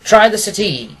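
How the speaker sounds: cordial Holmes. A narrator's reading. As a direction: welcome your old friend in with open arms. Holmes to his client.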